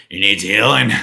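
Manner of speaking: loud rough smoker's rasp, laughing; chain smoker voice. Acted. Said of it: "talks like a chain smoker".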